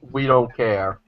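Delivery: ordinary delivery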